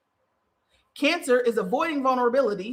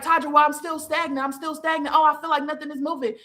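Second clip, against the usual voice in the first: higher, whiny mock voice of a stuck client; 5 semitones higher than usual. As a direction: whine as a client complaining to Taja. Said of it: "And it's like".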